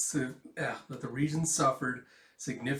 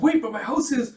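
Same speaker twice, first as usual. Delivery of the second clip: put-on panicked child; 11 semitones higher, 11 dB louder than usual. Child dragged away.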